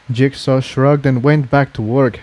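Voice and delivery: plain reading voice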